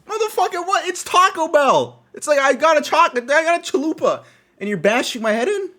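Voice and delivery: high, loud ranting yell; hammed-up outrage, pitch pushed way up; outraged disbelief. Storyteller ranting about being smacked over Taco Bell, outraged.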